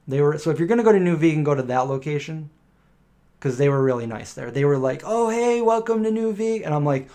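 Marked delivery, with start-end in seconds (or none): 5.0-6.7 bright high welcome, way up in pitch; cheerful greeting. Retold or imitated. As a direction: greet like an eager shop clerk. Cheerful staff greeting retold.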